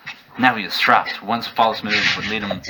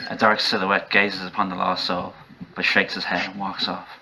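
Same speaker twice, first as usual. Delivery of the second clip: plain reading voice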